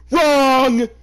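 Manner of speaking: high, loud, sharp cry; loud shout. Real, not acted. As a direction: yell it out. Reader shouts correction.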